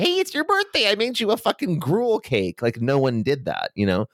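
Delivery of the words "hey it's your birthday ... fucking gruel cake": squeaky high mock villager; high mock peasant voice. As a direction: pitch way up, hammed-up peasant. Mock medieval peasant greeting.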